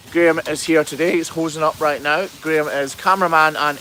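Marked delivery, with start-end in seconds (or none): none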